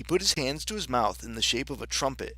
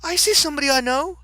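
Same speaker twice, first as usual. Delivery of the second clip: high, loud, quick Alice voice; excited cry; 7 dB louder, faster than usual. A narrator's reading. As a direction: call out the sighting excitedly. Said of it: "she cried".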